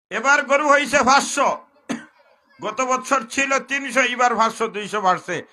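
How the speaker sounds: high, loud mocking tally; loud preaching. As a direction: shout out the cow numbers. Counting cows, shouted. (চিৎকার করে ব্যঙ্গ)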